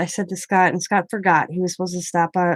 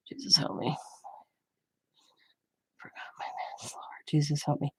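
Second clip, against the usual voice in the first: breathy unvoiced aside to herself; whispered aside; 4 semitones lower, 14 dB quieter than usual. Real, not acted. Whispering an aside to herself mid-vlog, a real whisper. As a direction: drop to a whisper, just for herself.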